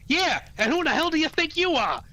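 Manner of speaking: high, exasperated character voice; hammed-up sarcastic retort; put-on Booster comeback.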